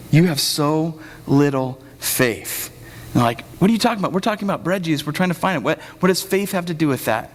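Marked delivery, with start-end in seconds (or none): 0.1-2.7 slow, word-by-word rebuke; measured reproach. Acted. Jesus to his arguing disciples, measured reproach. 3.6-7.3 higher-pitched, baffled pushback; mock arguing. Disciples puzzled by talk of faith, mock arguing. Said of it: "they're just all arguing".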